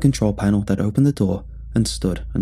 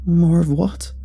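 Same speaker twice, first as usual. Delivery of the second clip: higher, anxious question; nervous; 8 semitones higher, 3 dB louder, slower than usual. A narrator's reading. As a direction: ask it nervously, voice rising. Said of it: "Red asked nervously".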